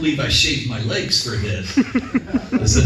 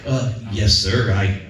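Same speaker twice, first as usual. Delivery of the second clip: overly polite collector; 6 semitones lower than usual. Retold or imitated. Collector's polite reply to abuse.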